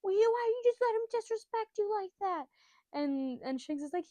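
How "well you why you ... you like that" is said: put-on high, loud indignant kid voice; Luffy. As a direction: protest like an outraged little boy. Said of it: "and Luffy's like".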